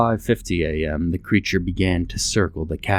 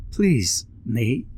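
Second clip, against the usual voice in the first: pleading; 5 semitones higher than usual. A narrator's reading. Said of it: "she pleaded".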